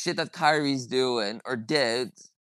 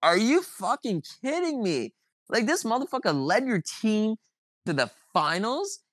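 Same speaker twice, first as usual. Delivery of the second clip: disbelief; 6 semitones higher than usual. Retold or imitated. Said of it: "I'm like".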